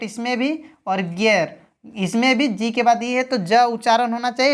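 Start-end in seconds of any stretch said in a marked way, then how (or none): 1.0-1.6 "गियर" opening on a hard g. Shown as a demonstration. Teacher listing exceptions for learners, "गियर" with hard g. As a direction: say "गियर" with g, not j. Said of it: "लेकिन ग उच्चारण हो रहा है".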